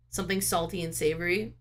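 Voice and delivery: ordinary delivery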